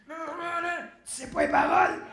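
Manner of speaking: loud put-on voice, incredulous question; imitated singer's challenge. Singer beside him on stage, loudly incredulous. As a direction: call him out loudly over the music. [name] catches him not knowing lyrics.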